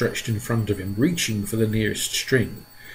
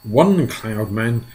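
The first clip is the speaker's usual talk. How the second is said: ordinary delivery, nothing marked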